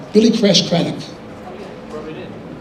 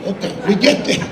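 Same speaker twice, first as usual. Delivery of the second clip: ordinary talk, nothing marked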